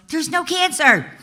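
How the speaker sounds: retold doctor's joyful reveal, high and loud; excited announcement. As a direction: announce it like the best news ever. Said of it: "And the doctor goes".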